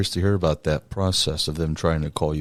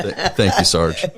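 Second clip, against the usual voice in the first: laughing; 3 dB louder than usual. Real, not acted. Laughing thanks after command.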